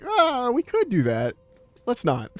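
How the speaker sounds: drawn-out polite yes, then a flat no; mock British politeness. Describing partner's British habit, mock polite brush-off. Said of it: "very British way to be like".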